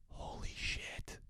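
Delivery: slow whispered awe; slow, whispered disbelief; stunned whisper. Retold or imitated. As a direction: breathe it out, frozen. Stunned as she sits down.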